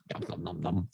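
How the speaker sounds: soft breathy chewing noise; whispered nom nom. Retold or imitated. Swallowing the nurse's pill, whispered munch.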